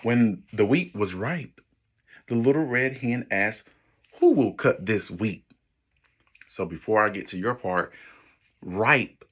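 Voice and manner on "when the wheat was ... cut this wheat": put-on lively storybook read for kids; story narration. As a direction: read it like a picture book to children. Reads picture book to class.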